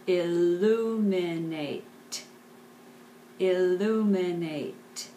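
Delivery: illuminate stretched out slowly; slow teacher's demonstration of a word; slow syllable-by-syllable model. Shown as a demonstration. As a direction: draw out each syllable of illuminate.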